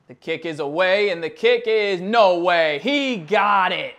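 loud field goal call; excited call. Real, not acted. Gamer calling the record field goal, loud. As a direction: call the kick as it sails through.

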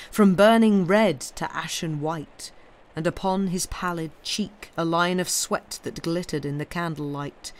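usual speaking voice

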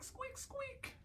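tiny high squeaking noises; high soft mouse squeak. Shown as a demonstration. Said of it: "don't forget to squeak".

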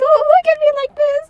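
high, loud embarrassed protest; flustered. Acted. Blushing at his friend, flustered protest. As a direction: fluster and push him off.

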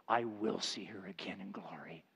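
put-on hushed whisper of an old man's hope; low, soft whisper of faith; retold whisper. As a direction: breathe it softly like a secret. Old widower's quiet hope.

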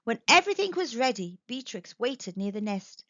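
ordinary delivery, nothing marked

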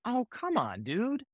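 high, soft plea; pained protest. Acted. Pete protesting his friend's pun, high pained protest.

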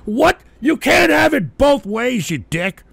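high, loud indignant outburst; angry shout. Real, not acted. Host ranting at a viewer who mocked his drinking, in an outraged shout. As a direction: yell it at the hypocrite, slowing down to hammer it.

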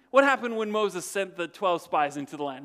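ordinary talk

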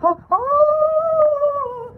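high, drawn-out shivery "oh"; chilling shiver. Shown as a demonstration. Showing a chilling shiver, high shivery oh. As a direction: shudder as if scared, but not quite. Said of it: "it's like a chilling shiver".

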